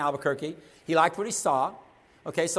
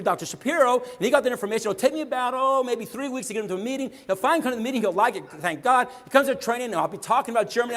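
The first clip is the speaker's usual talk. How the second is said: louder, higher, hammed-up excited retelling; mock eureka; 4 semitones higher, 5 dB louder than usual. Mocking the idea that he planned it all, hammed-up and excited. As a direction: play it as if it were all a brilliant plan.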